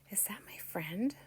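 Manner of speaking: soft, tentative wondering aloud; unsure, under the breath; hushed question. Retold or imitated. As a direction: half-whisper it, rising at the end. Acts out her uncertain question to herself.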